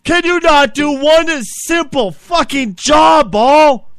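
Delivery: high, loud, slow chewing-out; furious berating. Acted. Host chewing out the producer on air. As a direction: tear into him word by word.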